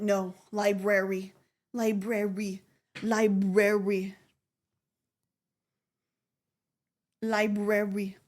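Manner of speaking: patient, monotone repeats of "library"; mom's correction. Retold or imitated. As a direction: drill "library" as "lie-brer-ree", evenly and deliberately. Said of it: "my mom had to, like, fight me".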